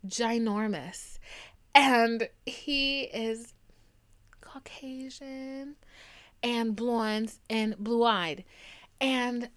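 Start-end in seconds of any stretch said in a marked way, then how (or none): 0.1-8.4 drawn out, very slow, word by word; slow dreamy listing of his looks; slow savouring. Real, not acted. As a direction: relish every word, slowly. Savouring describing him.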